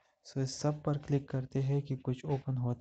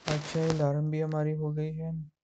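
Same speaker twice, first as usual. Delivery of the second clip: normal talking voice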